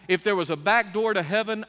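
normal talking voice

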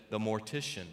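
low, soft, unbothered reply; calm deadpan wife with a laugh. Retold or imitated. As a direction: deliver the punchline coolly. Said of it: "the wife calmly said".

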